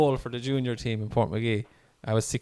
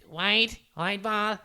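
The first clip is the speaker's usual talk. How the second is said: high, slow drawn-out referee call; mimicking a referee calling wide, high and slow; 9 semitones higher, much slower than usual. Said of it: "Referee goes".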